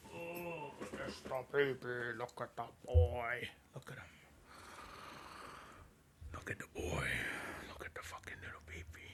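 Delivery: hushed, tender cooing to a puppy. Real, not acted. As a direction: whisper sweet nothings to the dog. Cooing softly to his dog.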